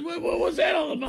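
very high falsetto whine; squeaky mock actor. Co-host mocking the suited actor in squeaky falsetto.

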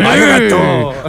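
slow, loud mock Japanese; drawn-out Arigato, Japanese accent; Japanese accent Portuguese. Shown as a demonstration. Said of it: "com sotaque japonês".

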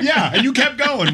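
high laughing reply. Real, not acted. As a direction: laugh as you answer. Laughs back at the tease.

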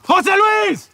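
loud, high shout of a name; shouting a lost friend's name; calling out. Acted. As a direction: yell for him across the dark field. Calling out in dark field.

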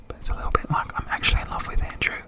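quick, hushed confession; confiding whisper. Pretend. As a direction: whisper it like a secret. Confiding a secret aside.

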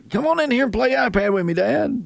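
put-on high, fast little kid pleading. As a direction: beg like an eager child. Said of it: "My kids take the iPads in there".

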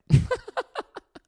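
high giggling laugh. Real, not acted. Podcaster cracking up at his invented name, high laugh. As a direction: burst out laughing at your own joke.

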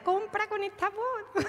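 put-on high, slow little-girl voice; baby voice. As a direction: talk like a three-year-old. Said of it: "hables como una niña de tres años".

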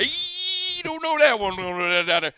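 a hint of a loud mock dude voice; lightly voiced wife talking like a buddy; imitating wife. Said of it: "how she talked like you and I do".

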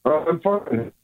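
faint, crackly phone call; bad phone line. Real, not acted. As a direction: caller breaking up on the line. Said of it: "You got a terrible connection".

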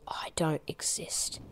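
breathy whisper. Shown as a demonstration. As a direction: whisper it to them softly. Whispered end of suggested line.

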